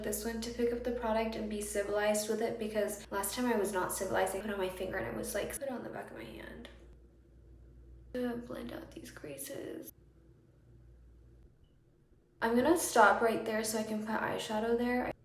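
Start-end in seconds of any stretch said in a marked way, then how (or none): 5.3-9.9 whispering. Real, not acted. Whispered asides while applying.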